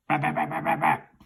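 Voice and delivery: toy gun noises. Retold or imitated. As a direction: playfully imitate a toy gun shooting. Imitates his toy gun firing.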